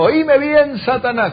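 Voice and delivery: a preacher voicing Jesus facing Satan; stern command to Satan. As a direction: call out the devil with authority. Jesus confronts the demons.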